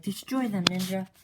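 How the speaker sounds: cartoon villain barking destroy them; villain command. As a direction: order your ninja to attack. Tallhead commands ninja.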